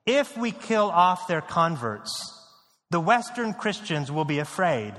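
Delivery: ordinary talk, nothing marked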